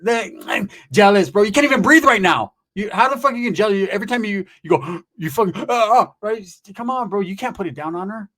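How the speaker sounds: loud, high, incredulous rant; mock shouting. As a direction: yell at him in disbelief. Yelling at jealous friend.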